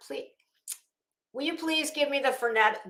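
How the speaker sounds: ordinary delivery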